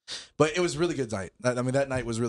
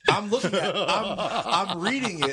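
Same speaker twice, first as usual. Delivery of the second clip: higher, laughing while recounting the label; can barely get it out for laughing; laughing through it; 6 semitones higher than usual. Real, not acted. Friend laughing as he recounts reading the bottle label.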